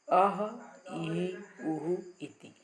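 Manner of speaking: अह इहि उहु each with a breathy h echo after the vowel; sounding out visarga. Shown as a demonstration. Teacher models visarga sounds for the class. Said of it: "अ में विसर्ग लगाया अह".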